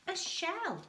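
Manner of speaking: ordinary delivery, nothing marked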